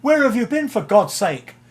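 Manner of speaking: pitched high, mock scolding where have you been; a band member fed up with the roadie; high mock exasperation; exasperated demand.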